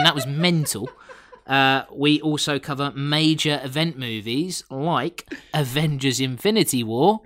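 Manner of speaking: ordinary talk, nothing marked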